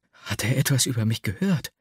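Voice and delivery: ordinary delivery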